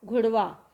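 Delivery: घुड़वा with the वा ending; घुड़वा said firmly; Awadhi pronunciation. Shown as a demonstration. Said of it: "अवधि में क्या हो जाएगा".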